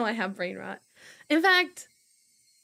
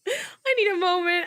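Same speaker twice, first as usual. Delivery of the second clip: laughing; 8 semitones higher than usual. Real, not acted. Streamer cracking up, laughing version. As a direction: say it through a laugh.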